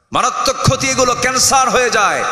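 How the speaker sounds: high, sustained sermon cry; ringing rebuke from the pulpit, rising at the end; forceful preaching. Acted. High-pitched preaching, voicing God's rebuke.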